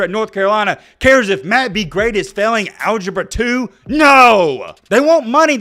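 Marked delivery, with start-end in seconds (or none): 3.9-4.7 loud emphatic no; shout. Real, not acted. Ranting at colleges. 4.9-5.6 high, loud stress on want. Real, not acted. Ranting at colleges.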